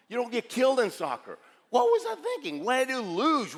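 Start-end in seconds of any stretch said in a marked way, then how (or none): 1.7-3.5 high, loud self-reproach; loud, high-pitched disbelief at yourself; mock exasperation. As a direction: wail it in hammed-up regret. Acted panicked regret.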